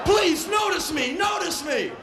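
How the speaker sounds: high shouted pleading to passing cabs; desperate pleading shout. Retold or imitated. Soaked tourist shouting at passing cabs. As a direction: beg to be seen, frantic and high. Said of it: "doing spastic ballet".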